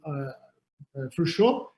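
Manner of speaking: ordinary delivery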